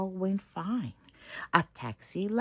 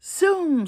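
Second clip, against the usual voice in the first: loud high zoom; loud high whoosh; 11 semitones higher, 9 dB louder than usual. Acted. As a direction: shout it like a speeding truck. Trucks soaring down avenue.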